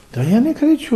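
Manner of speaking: defensive denial. Retold or imitated. Retold argument about shouting.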